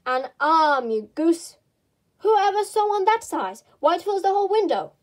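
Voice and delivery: angry scolding. Acted. Rabbit berating Pat over the arm, loud exasperated scold. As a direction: scold "An arm, you goose!" in disbelief.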